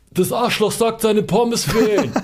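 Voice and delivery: high, stretched imitation bellow; retold yelling. Takeaway worker yelling to his colleague about the complaint, retold bellow. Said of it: "der Typ am Telefon brüllt so".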